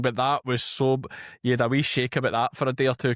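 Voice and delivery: normal talking voice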